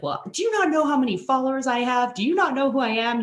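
a hint of a haughty influencer; mock entitled. Influencer berating the brand rep, indignant and high.

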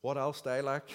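a little cocky and pleased with himself; light mock cockiness. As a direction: toss it off like a smug young man. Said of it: "he almost said it in a cocky way".

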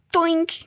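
high, sudden "toink"; imitated clink. A narrator's reading. Child imagining the piece hitting the counter, high imitated clink. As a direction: voice the plink of a small falling piece.

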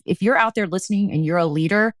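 ordinary delivery

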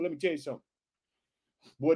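ordinary talk, nothing marked

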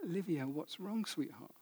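very soft, caring question; gentle retold concern. Father asking his crying daughter what is wrong, gently. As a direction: re-enact softly comforting a child.

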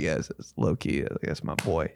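low, soft imitation of dad; grudging dad approval. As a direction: admit it quietly, as a reluctant dad. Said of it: "wasn't really giving in, but he was like".

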